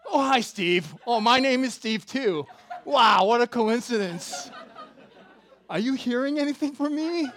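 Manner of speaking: loud, high mock enthusiasm; hammed-up gushing, angling for attention; over-eager greeting. Fan feigning chance meeting with prophetic man, over-eager.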